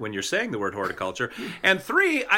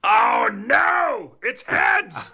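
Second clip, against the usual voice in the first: dismayed cry; 3 semitones higher, 3 dB louder, much slower than usual. Real, not acted. Coin lands on the losing side.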